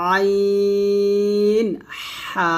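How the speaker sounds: আইন হা stretched slowly from the middle of the throat; throat letters. Shown as a demonstration. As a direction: press আইন হা out from mid-throat. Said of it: "খলকের মাঝখান থেকে উচ্চারণ করতে হবে". (গলার মাঝখান থেকে চাপ দিয়ে আইন হা)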